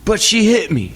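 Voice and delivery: put-on high, outraged kid; son protesting. Dad imitates his son protesting, high and outraged. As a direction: protest the unfairness. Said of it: "And he said".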